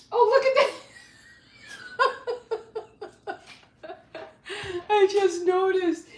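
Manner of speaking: high squeals of laughter; real giggling fit; laughing fit. Real, not acted.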